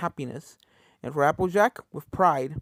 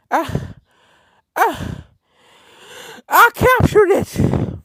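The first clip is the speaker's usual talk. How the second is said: breathless cry rising to a huge high yell; triumphant shout; 13 semitones higher, 10 dB louder, much slower than usual. Acted. Exhausted filly yelling her triumph. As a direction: scream the victory out, much higher and louder.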